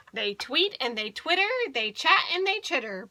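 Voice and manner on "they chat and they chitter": ordinary delivery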